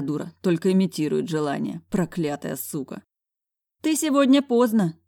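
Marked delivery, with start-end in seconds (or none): 3.8-5.0 higher, louder motherly rebuke; higher housekeeper voice; reproaching housekeeper. A narrator's reading. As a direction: scold him for coming late. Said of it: "попеняла мне Альбертовна".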